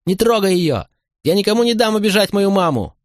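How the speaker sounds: put-on high-pitched child crying out; acted small boy yelling through tears, without extra volume; tearful boy's cry. A narrator's reading. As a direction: pitch way up like a distraught little boy. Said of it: "кричал мальчик сквозь слёзы".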